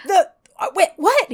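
high-pitched, stammering disbelief; stumbling, baffled imitation; mock brain-freeze. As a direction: play it totally dumbfounded. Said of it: "his brain fries".

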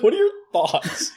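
high, laughing put-on question; laughing mock question. Asking the radiologist about holiday snaps, laughing. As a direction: ask it while cracking up. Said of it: "And you're like".

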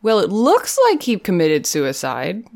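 lilting mock detective, stretching into a high finish; Sherlock. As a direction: draw out the last words in a knowing singsong. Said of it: "and Sherlock is like".

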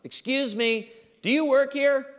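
high, loud customer question; inquiring customer. Retold or imitated. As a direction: pitch it up like a shopper flagging someone down. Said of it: "I get asked all the time".

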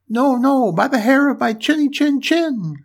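high, loud little piggy voice; defiant little pig. A narrator's reading. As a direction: refuse in a high-pitched storybook pig squeal. Pig refuses the wolf.

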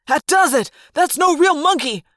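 high, loud outburst; angry yell. A narrator's reading. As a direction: yell as if finally fed up. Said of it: "the human controller yelled".

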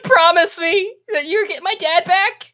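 put-on high, loud, desperate child; high-pitched, whiny plea; pleading small boy. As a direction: beg as an upset little kid. Boy begs for a promise.

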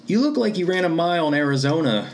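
put-on high, small squeaky kid voice; a boy's squeak trying to make a joke; squeaky mouse voice. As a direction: pipe it up like a nervous little mouse. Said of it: "I sounded like a mouse with my squeaky voice".

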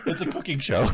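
amused, laugh-filled quip. Real, not acted. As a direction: joke while cracking up. Joking on the coleslaw mishearing.

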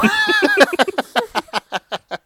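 high loud burst of laughter. Real, not acted. Cohost cracks up at the quip, high loud laugh. Big laugh after joke.

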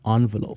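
"Envelope" with an opening AHN sound; correcting, with "Envelope" begun on "on"; British pronunciation. Shown as a demonstration. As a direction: say "Envelope" as ON-velope. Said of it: "You pronounced it wrong".

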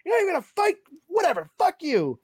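wife storming out, in a high mock voice; mock angry wife. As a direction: squeal it like the wife who's pissed off at him. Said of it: "She's like".

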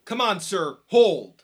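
loud stern command; commanding shout. Acted. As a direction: bark it to stop the fight. Breaking up a fight.

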